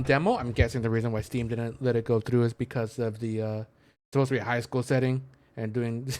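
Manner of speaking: normal talking voice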